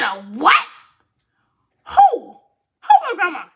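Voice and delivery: high, loud disbelief breaking into a laugh; shocked grandma. Retold or imitated. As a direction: shriek it like you can't believe it, laughing. Grandma hears the pregnancy news.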